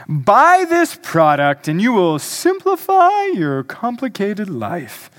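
hammed-up ad pitch with swooping emphasis; advertiser's voice. Pastor mimicking ads' lies to his congregation, advertiser's voice. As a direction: sell it like a cheesy commercial announcer. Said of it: "That's my advertiser's voice".

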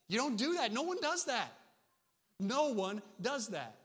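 high, loud disbelief; preacher's astonished emphasis; incredulous. Real, not acted. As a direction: hammer home how unthinkable it is. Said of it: "This is crazy".

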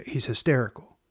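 ordinary talk, nothing marked

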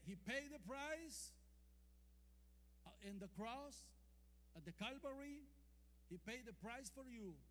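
ordinary delivery

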